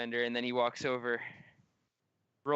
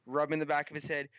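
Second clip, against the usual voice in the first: ordinary talk, nothing marked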